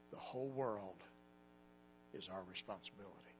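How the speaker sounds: low, soft, weighty; solemn hushed declaration. Acted. Preacher quietly urges young people to take up responsibility. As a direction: lay it on them quietly and gravely. Preacher closes altar call.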